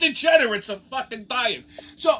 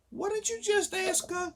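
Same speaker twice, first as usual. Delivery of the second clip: incredulous; 9 semitones higher, 5 dB quieter than usual. Retold or imitated. Stunned question to the bartender.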